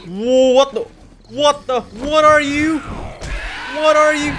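shrieking in fright; startled scream. Real, not acted. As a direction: scream it, high and loud. Monster suddenly appears.

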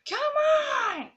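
high, drawn-out "come on"; exasperated cry. Real, not acted. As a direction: cry out in frustration at the game. Exasperated call during play.

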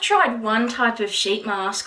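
fingers pressed on lips; talking through hand. Real, not acted. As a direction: hand muffling mouth. Seen 0: Fingers pressed over lips from 0.6s, smoothing sheet mask.

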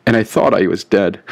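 plain reading voice

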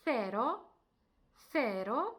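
cero with a lisped th at the start; Spanish c as th. Shown as a demonstration. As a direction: say cero as thero, tongue between the teeth. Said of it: "Наприклад, у таких словах".

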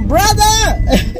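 high, mock-surprised brother; 1 incredulous echo. Real, not acted. Sister repeating the word in teasing disbelief.

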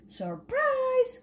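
high gleeful shout; spring the surprise from the tree; duckling popping out to surprise everyone; cried out. Acted. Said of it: "cried Dylan".

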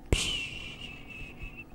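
long hissed "pshhh" of pouring rain; sustained shushing rain sound; imitated hard rain. Shown as a demonstration. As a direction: make a drawn-out rushing downpour noise. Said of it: "like that".

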